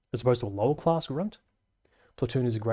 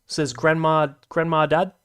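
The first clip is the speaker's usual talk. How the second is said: normal talking voice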